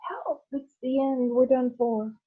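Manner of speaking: high, frantic cry for help; panicked shout. Acted. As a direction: yelp like he's about to crash. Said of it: "Grandpa Joe shouted".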